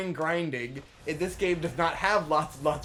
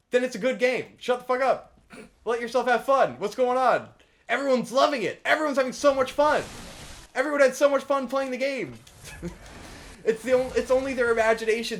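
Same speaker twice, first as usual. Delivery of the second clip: loud, much higher, fed-up outburst; heated rant; 6 semitones higher, 4 dB louder than usual. Real, not acted. Ranting at Pokemon critics, loud and fed up. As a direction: scold the whole fandom. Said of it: "That's, that's my rant".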